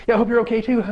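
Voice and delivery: high, loud, breezy mock politeness; brush-off reply. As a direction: toss it back without taking it in. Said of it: "'cause our response is".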